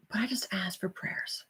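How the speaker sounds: soft unvoiced confiding whisper. Real, not acted. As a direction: lean in and whisper it. Confiding hurt story.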